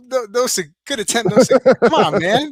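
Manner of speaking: high, loud playful protest. Real, not acted. Host defends his accent attempt to co-host, high playful protest. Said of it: "I needed to get in character".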